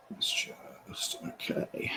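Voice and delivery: near-whispered, absent-minded aside; muttering to self. Real, not acted. Presenter talking to self while the headset won't respond, muttering. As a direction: talk under your breath, very softly.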